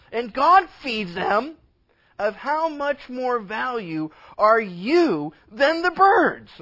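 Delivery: loud, high, emphatic scripture delivery; forceful preaching. Real, not acted. Preacher urging believers to quit worrying, forceful preaching. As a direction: preach it at full force, stretching key words.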